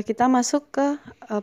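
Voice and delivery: normal talking voice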